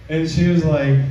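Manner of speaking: ordinary talk, nothing marked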